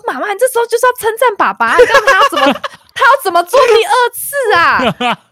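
high-pitched insistent lecture to mom; urgent excited plea. Retold or imitated. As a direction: rush in and urge mom to praise dad. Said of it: "我说".

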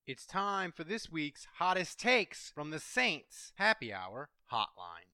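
announcer intro. Acted. Recorded hotline intro read.

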